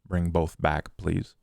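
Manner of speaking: usual speaking voice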